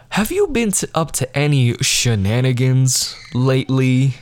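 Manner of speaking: mock-innocent prying about "shenanigans"; teacher's loaded question. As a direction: lean on "shenanigans" with a knowing lilt. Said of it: "She kept using the word shenanigans".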